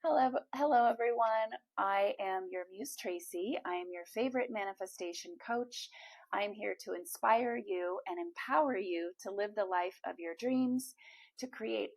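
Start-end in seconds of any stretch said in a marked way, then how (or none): none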